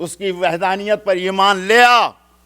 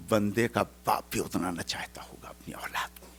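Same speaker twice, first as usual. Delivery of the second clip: whispered, intimate aside; hushed sermon whisper; 7 semitones lower, 15 dB quieter than usual. Acted. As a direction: whisper it like a secret of love. Preacher on God's love.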